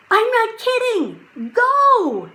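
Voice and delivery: high, loud fierce yell; mean it, scream it out; angry shout. A narrator's reading. Jack's final warning to the wolves, loud angry shout. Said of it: "shouted Jack".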